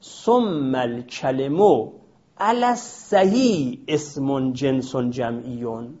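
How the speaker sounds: slow, measured reading of the Arabic line; slow text recitation. Shown as a demonstration. Teacher reciting the book's line to students, slow and measured. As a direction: recite the quoted text slowly. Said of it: "تعبیرشون این هست".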